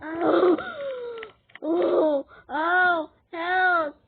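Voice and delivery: loud yelps of pain; play-hurt, shouted cries for help; calling for help. Pretend. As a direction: cry out as you take hits. Hurt in game, calls for help.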